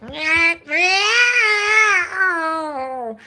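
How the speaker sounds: high, loud mock cat yowls; cat yowling imitation. Streamer mimics cats mating before going live, mock yowling. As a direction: yowl like two cats mating. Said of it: "I was like".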